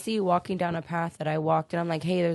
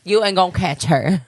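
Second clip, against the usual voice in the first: loud laughing rant; 3 semitones higher, 11 dB louder than usual. Real, not acted. Loud joking defense of her mom.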